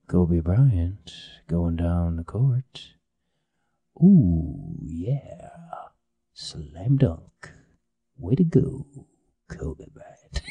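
slow, low, hushed commentary; soft, deep, drawn-out cool sportscaster; mellow sports guy. Retold or imitated. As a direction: call the game like a laid-back jazz host. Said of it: "real cool, mellow sports guy".

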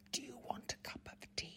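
voiceless, exaggerated mouthing over factory noise; silently mouthed. Shown as a demonstration. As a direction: mouth it big with almost no sound. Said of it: "make an exaggerated face, as you've just done".

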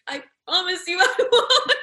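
high-pitched, laughing vow; laughing promise. Retold or imitated. Actor retells promising the Wolverhampton women, high and laughing. Acting out her eager promise.

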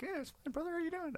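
tiny high squeaky cartoon-mouse voice; soft and very high, like Mickey Mouse; Vern Troyer. Small actor reassuring the man lifting him, Vern Troyer. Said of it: "I don't know why I did Mickey Mouse again".

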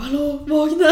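high, loud mock wake-up call with laughter; calling to wake someone. Friend waking Adrian at night, high loud call. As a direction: call out to rouse a sleeper, amused.

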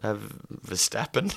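ordinary delivery

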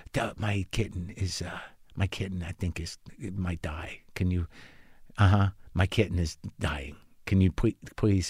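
very soft, low, halting plea; meek interjection. Retold or imitated. Owner interrupting reminiscing vet about dying kitten, mock meek. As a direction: try timidly to cut in, barely audible.

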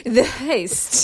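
Heist drawn out slowly and posh. Pretend. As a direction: say The Heist like a toff. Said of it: "In a posh voice".

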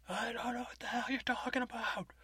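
whispered, high and tense; hoarse whisper. Retold or imitated. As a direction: hiss it out in a frantic whisper. RW hisses denial.

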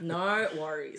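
low, understated "No worries"; imitating his tone. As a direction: say "No worries" low and soft, mimicking a friend. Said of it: "That's the tone he says it".

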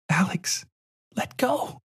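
put-on very high, soft child voice begging; a girl in pain urging him to let go; pleading little girl. A narrator's reading.

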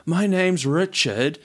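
slowly drawn-out name; apologetic caller slowing right down; slow self-introduction. Retold or imitated. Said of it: "I'll talk a bit slower".

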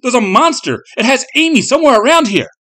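high, loud, frantic plea; urgent high-pitched yelling to wake someone; shouted. A narrator's reading. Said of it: "he shouted".

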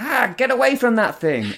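mock her yelping, puppet-style; hammed-up Muppet cry. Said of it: "sounded like a Muppet".

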